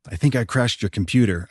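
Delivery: plain reading voice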